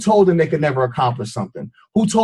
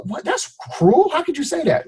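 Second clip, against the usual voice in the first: mock shocked listener; 11 semitones higher than usual. Mock audience outrage.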